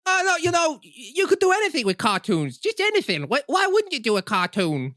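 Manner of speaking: high, sarcastic sing-song; mock the idea in falsetto. Teasing co-host about celebrity cartoons, high sarcastic voice.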